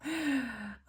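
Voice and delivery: a guilty little laugh at the debt; embarrassed laugh. Real, not acted. Laughing at own debt total before viewers, embarrassed laugh. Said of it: "I'm not laughing because it's funny".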